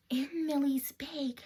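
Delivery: hushed secret whisper. Acted. Teasing the children about the hidden bag, whispered. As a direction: breathe it like a secret for little ones. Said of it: "listen really quietly".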